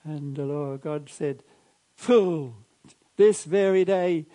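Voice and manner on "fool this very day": loud slow rebuke; booming rebuke. Acted. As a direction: thunder it down like God. Retelling God's rebuke in sermon.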